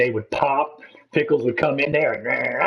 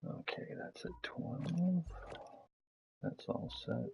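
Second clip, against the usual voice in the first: whisper; 3 semitones higher, 17 dB quieter, slower than usual. Real, not acted. Whispered aside.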